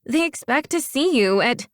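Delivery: put-on high girlish complaint, cut short; petulant, swooping high voice; complaining. A narrator's reading. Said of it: "she complained".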